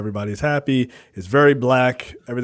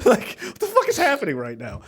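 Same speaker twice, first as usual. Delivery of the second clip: mock bewilderment; 11 semitones higher than usual. Retelling his own reaction in the car, higher and bewildered. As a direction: ask it high in disbelief. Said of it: "I was like".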